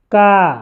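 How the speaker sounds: का with a long, held aa; pronunciation demo. Shown as a demonstration. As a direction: sound out का as one drawn-out syllable. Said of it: "इसका उच्चारण क्या होगा". (का, लंबे आ के साथ)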